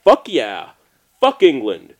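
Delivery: loud, punchy mock shout; imitated rallying yell; retold defiant cheer. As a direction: act out rowdy pub defiance. Retold Irish guys' defiant cheer.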